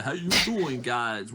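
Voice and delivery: hammed-up Staten Island accent; Staten Island greeting. Locals greeting the stranded driver, Staten Island accent. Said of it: "Like, the".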